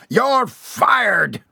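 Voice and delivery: high, furious bellow. A narrator's reading. Boss firing his employee, bellowing. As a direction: explode on the dismissal. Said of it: "Mister Wolfenstein bellowed".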